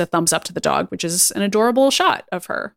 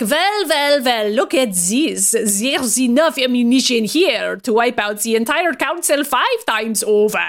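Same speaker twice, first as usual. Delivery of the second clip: mock Transylvanian accent; 2 semitones higher than usual. Vampire gloating over silver bullets, hammed-up Transylvanian accent. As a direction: ham up a Transylvanian villain gloating over the ammo. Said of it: "kind of a Transylvanian accent".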